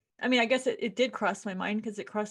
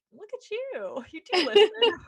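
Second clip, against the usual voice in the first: laughing praise; 9 semitones higher, 8 dB louder than usual. Retold or imitated.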